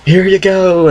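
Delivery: hammed-up high voice to a frog; gentle to frog. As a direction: coo it like handing over a pet. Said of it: "I'm like".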